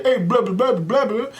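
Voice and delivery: mocking how people imitate him. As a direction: parody folks copying his talk. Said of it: "y'all say".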